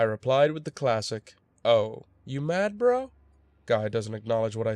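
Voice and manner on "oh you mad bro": slow, with a high rising "bro?"; mocking taunt. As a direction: needle him, lifting the last word high. Taunting reply to an angry player.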